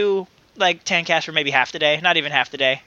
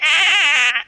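creaky floor imitation; 9 semitones higher, 4 dB louder than usual. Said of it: "it goes like".

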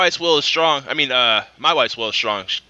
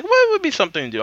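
musing. Retold or imitated. Retelling own thought about birthday plans.

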